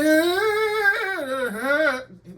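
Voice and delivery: high laughing wail, sing-song; mocking laugh. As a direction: mimic the crowd's silly laughing cry. They all went, reaction.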